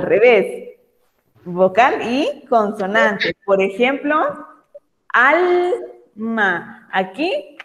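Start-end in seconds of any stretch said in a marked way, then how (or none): 5.1-6.9 alma drawn out, al and ma apart; slow syllable demo. Shown as a demonstration. Teacher to pupils, showing vowel then consonant slowly. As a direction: stretch alma for the children. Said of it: "Primero está la vocal y después la consonante".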